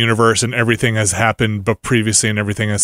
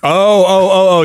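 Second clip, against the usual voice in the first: sudden realization; 7 semitones higher, 5 dB louder than usual. Real, not acted. Sudden realization about horse hooves.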